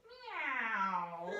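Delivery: a drawn-out mock meow; playful cat noise; funny cat meow. Shown as a demonstration. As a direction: stretch it into a silly cat meow. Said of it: "some nice funny noises".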